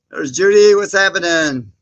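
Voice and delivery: loud, high cheerful hello; excited greeting. Real, not acted. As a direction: greet a friend as they join. Greeting a viewer loudly.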